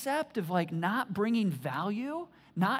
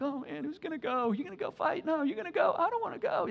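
mock scared soldiers; 8 semitones higher than usual. Scared soldiers voiced in sermon.

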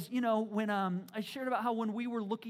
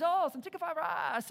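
high, hammed-up Chinese accent joke; a corny put-on Chinese accent; mock Chinese accent; 8 semitones higher, 3 dB louder than usual. Preacher joking about tongues, mock Chinese accent. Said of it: "Or, or Chinese".

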